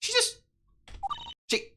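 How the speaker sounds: very high startled yelling; shocked shout. Real, not acted. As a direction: cry out in disbelief. Character vanishes, streamer shocked.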